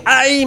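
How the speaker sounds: a high, soft ay of pain. Retold or imitated. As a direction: sigh out a small high ouch. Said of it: "me quejé porque me dolió". (un ay agudo y suave de dolor)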